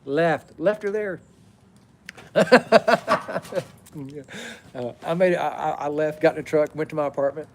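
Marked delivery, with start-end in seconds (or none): 2.1-3.6 loud laugh bursts. Real, not acted. Laughs after leaving her.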